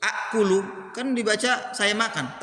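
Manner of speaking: ordinary talk, nothing marked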